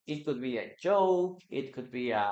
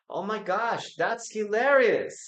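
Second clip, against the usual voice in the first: high, bright delighted exclamation; amused reaction; 7 semitones higher than usual. Shown as a demonstration. Teacher modelling delight at a joke. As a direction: react as if the joke just landed.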